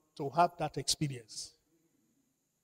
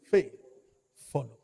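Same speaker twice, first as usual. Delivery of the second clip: usual speaking voice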